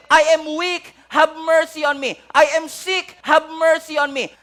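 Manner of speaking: loud, high, slow pleading; crying out for mercy. Acted. Sinner crying out to Jesus, loud desperate plea. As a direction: beg for mercy at the top of your voice. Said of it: "yung puso mo ay sumisigaw".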